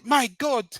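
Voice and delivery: exaggerated African accent, mock shock; bad African accent. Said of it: "our bad African accent for dramatic effect".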